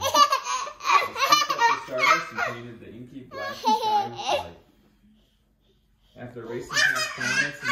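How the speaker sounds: high, loud, giggly reading; laughing through reading. Real, not acted. Parent reading bedtime story while laughing at the toddler.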